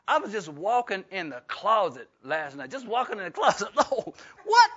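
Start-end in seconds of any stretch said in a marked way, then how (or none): 2.7-4.7 laughing in surprise, voice jumping high; laughing disbelief. Real, not acted. Pastor laughing about his knee in the closet, high and amused. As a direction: laugh at your own knee giving out. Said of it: "it's just really awkward".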